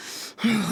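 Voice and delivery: soft, falling sigh of resignation; heavy sigh. Acted. Classmate sighing over the ruined project. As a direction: let out a tired breath. Weary before verdict.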